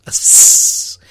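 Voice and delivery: loud, sharp hiss on the S; hissed S sound. Shown as a demonstration. Priest objecting to a misspelling of his name, loud hissed S. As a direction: stress the S as a loud "sss". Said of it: "It's never been spelled with an S".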